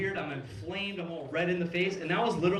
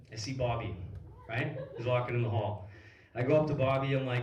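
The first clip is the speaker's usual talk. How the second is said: usual speaking voice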